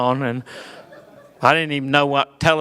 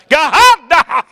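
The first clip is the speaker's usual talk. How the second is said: high, loud stretched preacher cry; fire-breathing preacher; 9 semitones higher, 7 dB louder than usual. Retold or imitated. Pentecostal dad crying out God, loud and stretched. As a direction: belt God like a revival preacher. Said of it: "Says God with three syllables".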